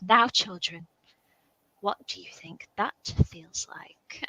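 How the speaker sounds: slow, hushed sinister storyteller; Morticia Addams. Acted. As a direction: imitate a creepy reader, dropping to a slow near-whisper. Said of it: "when Morticia takes on the job reading".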